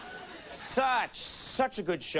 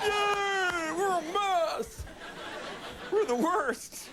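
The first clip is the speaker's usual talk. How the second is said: mock cheer; much slower than usual. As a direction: cheer it like a rally crowd. Mock cheering about the country.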